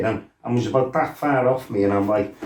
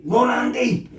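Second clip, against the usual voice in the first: high urgent call to a mate downstairs; 11 semitones higher than usual. Retold or imitated. Calling down to his mate to flee, high urgent shout. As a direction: warn him to get out now.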